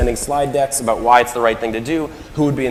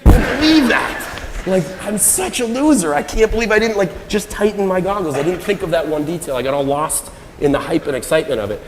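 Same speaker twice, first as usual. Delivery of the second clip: a touch of anguished self-scolding; self-reproach; 7 semitones higher than usual. Retold or imitated. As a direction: replay the midnight regret, beating yourself up. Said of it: "I wake up at night, and I'm like".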